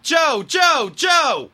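high, impatient scolding yell; imitating a friend's exasperated telling-off; telling-off shout. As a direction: scold by calling the name over and over. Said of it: "the way he'd tell me off".

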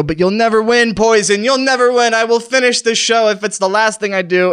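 rally against the enemy with your last strength; defiant vow. Pretend. Dying host vows to the poison he'll finish the show, defiant.

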